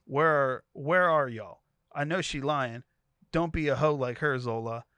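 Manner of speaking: loud phone yell over speaker; cursing out. Retold or imitated. Pimp cursing Zola out over the phone, loud. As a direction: bark at her down the phone, loud. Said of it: "He starts cursing me out".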